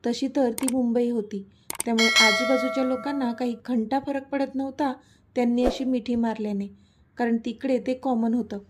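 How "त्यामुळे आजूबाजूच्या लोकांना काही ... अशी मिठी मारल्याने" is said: usual speaking voice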